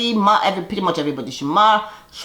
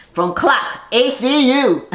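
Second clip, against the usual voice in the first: announcer intro; 6 semitones higher, 3 dB louder, slower than usual. Pretend. Repeats the entrance announcement.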